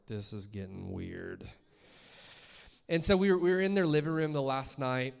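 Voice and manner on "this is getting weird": low, slow, softer aside; uneasy inner aside. Retold or imitated. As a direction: drop low and draw out the unease. Retold uneasy inner reaction.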